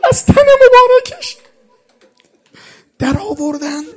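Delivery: wailing, sobbing high cry; weeping lament. Real, not acted. Preacher lamenting the stripped shirt to mourners, sobbing. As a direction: break into a mourning wail.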